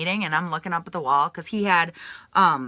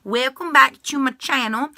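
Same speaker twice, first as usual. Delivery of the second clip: higher-pitched welcome, pointed repeat; emphatic re-greeting; 6 semitones higher, 4 dB louder than usual. Real, not acted. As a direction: lift the pitch to greet newcomers.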